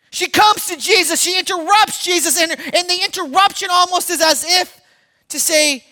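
ordinary talk, nothing marked

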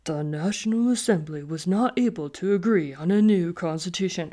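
put-on low reading voice, lightly done; mock deep voice. Teacher reading to teenagers for laughs, mock deep voice. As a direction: read it out in a comic pretend-deep voice. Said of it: "about three octaves lower than my normal voice".

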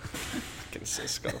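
hushed, laughing curse under the breath; annoyed whisper. Real, not acted. Interviewer cursing the phone under his breath, whispered. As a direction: grumble it softly with a laugh.

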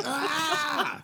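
high mock scream re-enacting losing it; retold wordless scream. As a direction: let out a sudden high yell, no words. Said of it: "I scream so loud, just not even words".

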